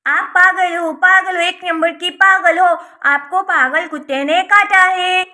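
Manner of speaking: loud, declaimed insults; loud taunting. Acted. Niece insulting her aunt on a dare, loud taunting. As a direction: hurl it out loudly, stretching the last line.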